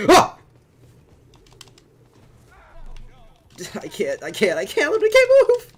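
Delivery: high, loud panicked yelling; panicking gamer. Real, not acted. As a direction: yell in panic, voice shooting up. Trapped in game, panicking.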